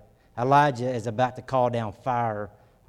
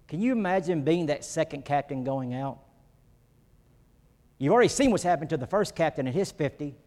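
usual speaking voice

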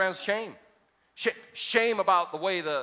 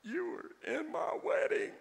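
high, slow, teary woman's voice; mimicking her crying; 12 semitones higher, 6 dB quieter, slower than usual. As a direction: play the hurt woman, lips quivering. She started crying.

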